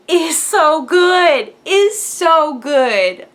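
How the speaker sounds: slow, loud, drawn-out praise; stretched-out loud gushing. Real, not acted. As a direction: savour each word with delight. Gushing about knitwear.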